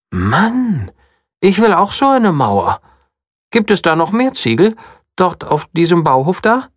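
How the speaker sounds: lightly voiced eager princess. A narrator's reading. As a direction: beg for a wall of your own. Princess impressed, wants wall.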